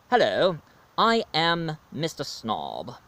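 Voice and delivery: slow, stuck-up British accent; snobbish British accent. Shown as a demonstration. American showing off his British accent as Mister Snob, snobbish. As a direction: introduce yourself as a posh British snob. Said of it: "how to do a British accent".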